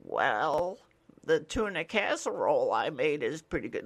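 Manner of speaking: lightly voiced old lady; a hint of a sweet elderly neighbour; old resident. Old resident answers the mailman literally, a hint of old-lady voice. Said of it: "And they're like".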